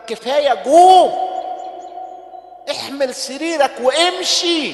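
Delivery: loud, strong command; commanding loudly. Acted. Jesus telling the healed man to rise, loud command. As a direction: order him to rise.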